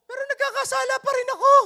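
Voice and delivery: very high, loud, hurried wail; high-pitched confession. Acted. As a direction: cry it out high as a troubled believer. Acting a troubled believer's confession.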